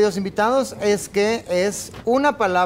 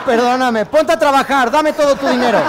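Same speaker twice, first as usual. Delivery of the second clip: high, loud grasping stage mother; greedy showbiz mom; 5 semitones higher, 5 dB louder than usual. Retold or imitated. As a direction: play a greedy mother demanding money. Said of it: "esa es la de [name]".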